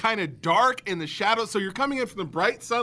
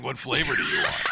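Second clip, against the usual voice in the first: goofy low truck guy voice; 9 semitones lower than usual. Retold or imitated. Friend mimics the truck guy offering ice cream, in a low goofy voice. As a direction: drop into a dopey low voice, laughing.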